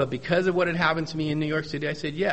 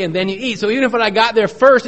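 ordinary delivery

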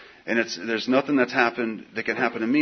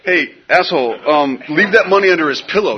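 higher, louder bossy sponsor; mock sponsor; 6 semitones higher, 8 dB louder than usual. Sponsor ordering him to leave the money, higher and louder. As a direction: boss him around as the sponsor. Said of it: "He's just like".

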